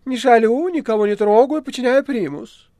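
high, loud, sulky cat voice; a grumpy cat defending himself. Acted. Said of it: "недружелюбно насупившись, проговорил кот".

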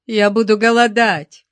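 высокий, громкий плаксивый возглас; взмолись громко, на высокой ноте; отчаянная жалоба. A narrator's reading. Управляющий умоляет допрашивающих о сочувствии. (жалобно, с отчаянием)

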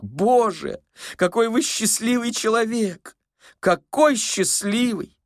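high, wailing exclamation; envious old man. A narrator's reading. Old man envying the stranger's dreams, high plaintive wonder. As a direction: marvel high, drawing out the last words.

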